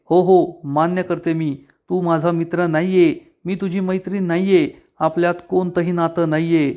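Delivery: plain reading voice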